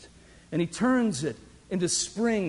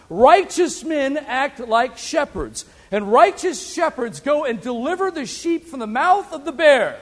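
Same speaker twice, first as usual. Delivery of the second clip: higher, louder preacher's proclamation; preaching emphasis; 7 semitones higher, 6 dB louder than usual. Acted. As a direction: proclaim it slowly from the pulpit. Preaching climax.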